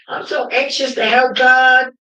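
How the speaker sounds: high, loud cry of devotion; 1 fervent shout. Real, not acted. Woman proclaiming her devotion, shouting. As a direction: shout your love for God. Fervent declaration about God.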